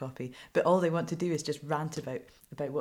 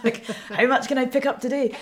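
higher, louder, breaking up with laughter; laughing; 8 semitones higher, 11 dB louder than usual. Real, not acted. Reporter joking to interviewer about weightlifting, laughing as she talks. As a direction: laugh through the words.

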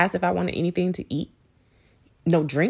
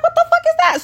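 high, louder startled question; alarmed reaction; 5 dB louder, faster than usual. Retold or imitated. Spider-phobic woman seeing a moving speck, high-pitched alarm. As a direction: push it high and loud, startled.

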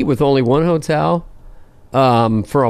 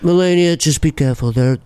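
wary president cautioning his wife; paranoid, old-school president voice; Trump. Acted. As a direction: warn her in a presidential impression. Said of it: "I do him being, you know, with".

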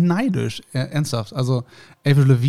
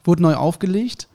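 ordinary talk

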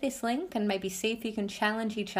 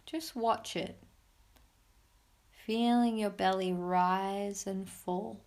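soft spa whisper; 3 dB quieter than usual. Acted. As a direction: guide them gently into calm. Leads a guided meditation.

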